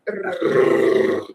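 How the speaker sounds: long, low tongue-tip trill; rolled Russian R. Shown as a demonstration. Coach showing student where the tongue hits, rolled R. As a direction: roll a sustained Russian "Rr". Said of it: "make that sound".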